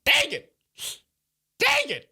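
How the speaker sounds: very high squeaky "dang it"; cartoonish high-pitched exasperation; mock dismay. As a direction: play up comic frustration in a high-pitched yelp. Mock upset at being left out.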